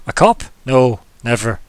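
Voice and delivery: sharp loud scoff at the idea; indignant denial. Acted. Innkeeper scoffing at the player, loud denial. NPC denies being a cop.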